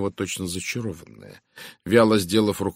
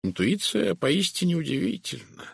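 musing in a higher voice; thoughtful; 10 semitones higher than usual. A narrator's reading. Poirot muses on intuition, thoughtful. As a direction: wonder aloud to yourself. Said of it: "задумчиво пробурчал Пуаро".

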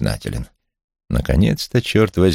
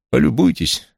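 higher-pitched, proud presentation; 7 semitones higher than usual. A narrator's reading. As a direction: show it off with pride. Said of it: "гордо произнёс я". (с гордостью)

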